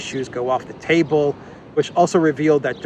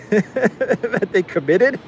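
laughing; 7 semitones higher, much slower than usual. Real, not acted. Laughing at Bush being pranked.

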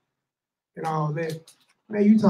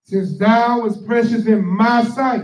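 slow, louder, higher scripture reading; 5 semitones higher, 6 dB louder, slower than usual. Acted. Reading Isaiah aloud for the preacher, proclaiming slowly.

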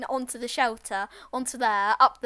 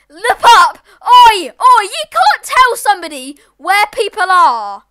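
yelling at a cheater; angry shout; 8 semitones higher, 19 dB louder, slower than usual. Real, not acted. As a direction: bellow it out in anger. Yelling at a player who cheated.

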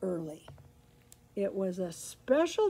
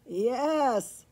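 loud, lightly neighed reply; neighing horse; 3 semitones higher, 5 dB louder than usual. A narrator's reading. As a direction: answer with a hint of a horse's neigh. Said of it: "he neighed".

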